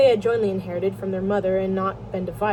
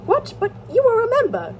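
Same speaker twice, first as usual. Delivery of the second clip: high, louder startled question; acted surprise; 13 semitones higher, 5 dB louder than usual. Brother learns Levin left the Zemstvo, astonished. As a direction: react with high-pitched disbelief.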